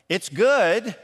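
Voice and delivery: flat, half-hearted it's good; lukewarm. Shown as a demonstration. Family member answering the cook, lukewarm and unconvinced. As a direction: say "good" with no enthusiasm, a small rise. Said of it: "same words, just different inflection".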